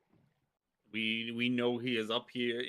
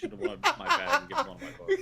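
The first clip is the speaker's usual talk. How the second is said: laughing; 5 dB louder, faster than usual. Real, not acted. Laughing while speaking.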